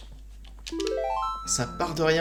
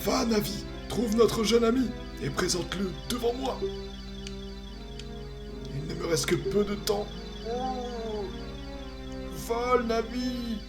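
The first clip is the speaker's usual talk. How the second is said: higher, solemn ancient tree spirit; wise old tree; 10 semitones higher than usual. Acted. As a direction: speak as a grave forest guardian. Voices the Deku Tree.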